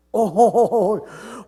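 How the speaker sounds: loud, rolling "oh-ho-ho" laugh; big drawn-out laugh. Retold or imitated. Recalls his delighted reaction to question. (크게 터뜨리는 너털웃음)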